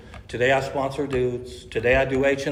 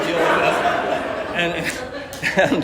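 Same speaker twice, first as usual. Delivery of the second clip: laughing; 3 semitones higher, much slower than usual. Real, not acted. Laughing while retelling breakup.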